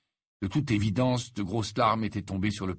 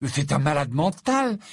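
higher, louder indignant outburst; furious exclamation; 6 semitones higher, 5 dB louder than usual. A narrator's reading. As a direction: exclaim it in outrage, pitch jumping up. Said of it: "s'exclama-t-il d'un ton furieux". (exclamation furieuse, plus aiguë et plus forte)

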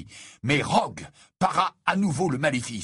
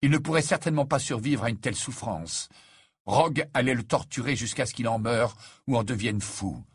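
usual speaking voice

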